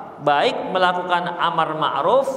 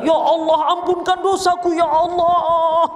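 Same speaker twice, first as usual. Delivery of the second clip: mock theatrical wailing prayer; 10 semitones higher than usual. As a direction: ham up a shouted prayer like bad acting. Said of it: "nggak usah teriak-teriak".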